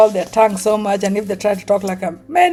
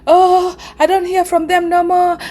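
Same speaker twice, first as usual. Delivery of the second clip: complaining mother; 7 semitones higher, 4 dB louder than usual. Retold or imitated. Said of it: "mother is telling".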